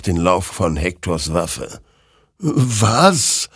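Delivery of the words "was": higher, wondering question; taken aback; puzzled surprise. A narrator's reading. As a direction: ask in startled puzzlement. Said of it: "wunderte sich dieser".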